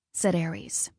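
ordinary delivery

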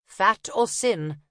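put-on slow German-accented question. Una questioning the copper, slow German accent. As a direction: ask it slowly with a German accent. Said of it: "feigning a German accent".

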